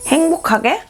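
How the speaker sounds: ordinary talk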